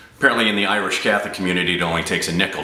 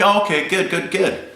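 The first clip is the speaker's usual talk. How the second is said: lightly voiced dad, pitched high; quick high-pitched acknowledgement as Dad; imitated father; 12 semitones higher than usual. Father hearing his son's plans on the phone, high-pitched approval.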